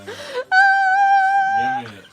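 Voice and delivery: very high, stretched laughing wail; crying with laughter. Real, not acted. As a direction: beg through helpless laughter. Pleading while laughing hard.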